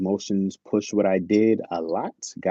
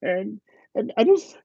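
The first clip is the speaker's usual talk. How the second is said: much higher, hammed-up tearful rant; mock crying date. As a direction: play the date falling apart in fake tears. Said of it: "she just starts crying on your date".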